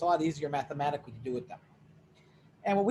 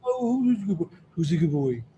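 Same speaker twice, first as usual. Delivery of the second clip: talking to a dog; 3 dB louder than usual. Real, not acted. Teacher baby-talks the dog.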